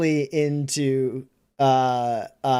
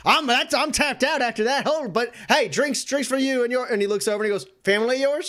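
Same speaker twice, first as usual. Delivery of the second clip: higher, friendly barman voice; bartender; 7 semitones higher, faster than usual. Acted. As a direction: beaten but generous.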